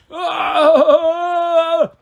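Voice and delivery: high, loud cry of a beaten giant; pained moan. A narrator's reading. Fallen Cyclops crying out under Percy's sword. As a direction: cry out at the blade tip. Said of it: "Polyphemus moaned".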